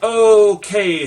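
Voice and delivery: sudden loud high okay, half mock; a mock-stunned burst; startled okay. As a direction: blurt it out like it just dawned on you. Stunned man waking on acid trip.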